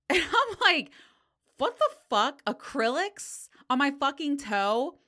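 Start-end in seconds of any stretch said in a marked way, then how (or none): 1.6-5.0 loud, high-pitched retold disbelief; incredulous outburst. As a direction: blurt it out, appalled and loud. Acted shocked reply to nail tech.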